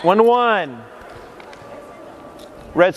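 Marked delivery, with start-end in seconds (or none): 0.0-0.8 calling out. Real, not acted. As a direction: announce it across the gym. Coach calling the score.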